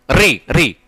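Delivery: री, री with the tongue curled back to the roof; teacher shows री with tongue against मूर्धा; retroflex demo. Shown as a demonstration. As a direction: tap री, री off the palate. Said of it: "री को बोलते वक्त".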